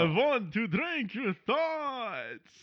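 high, slow ghostly vampire; hammy undead count, drawn out. Pretend. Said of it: "you got real ghostly there".

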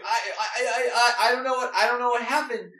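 high, fast, stammering panic; high panicked stammer; flustered Doll. Acted. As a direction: babble it out in a fluster. Doll panics after the alarm.

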